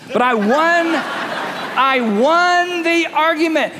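high, drawn-out laughing insistence; mock triumph. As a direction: crow slowly over a hollow win, hammed-up. Mimics arguer insisting on victory.

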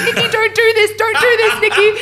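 high, loud imitation of panicking dad; mock desperate pleading. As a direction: beg her to stop, hammed up.

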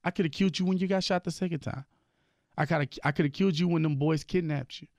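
God's stern rebuke. Retold or imitated. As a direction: rebuke him as God, incredulous. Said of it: "he named when I got shot".

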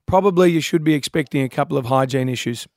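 normal talking voice

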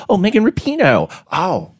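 put-on Megan Rapinoe said as "Meykin Rupinoe" in a light Baltimore accent. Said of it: "It's the Baltimore accent".

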